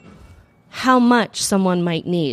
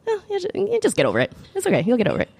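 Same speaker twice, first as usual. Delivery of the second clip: breezy dismissive mom; 8 semitones higher, faster than usual. Retold or imitated. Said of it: "And she's like".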